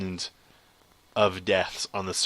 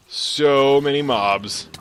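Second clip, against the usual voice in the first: louder, very drawn-out "so many mobs"; overwhelmed wail; 3 semitones higher, 7 dB louder, much slower than usual. Real, not acted. Player facing too many mobs, loud slow wail. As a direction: stretch SO loud and long.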